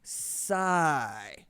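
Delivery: Osai in two long syllables; sounded-out pronunciation. Retold or imitated. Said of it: "I'm writing it out phonetically".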